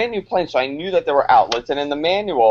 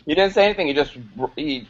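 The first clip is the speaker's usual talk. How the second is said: normal talking voice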